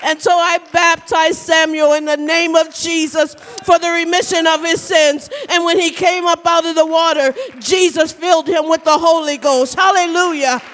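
high, forceful climactic testimony; exultant pulpit proclamation; fervent preaching. Acted. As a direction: drive the testimony home to a hallelujah.

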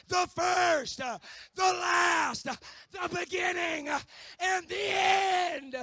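fiery sermon shouting; preaching. Acted. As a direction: preach it with full force. Preaching at full force.

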